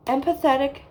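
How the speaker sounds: ordinary talk, nothing marked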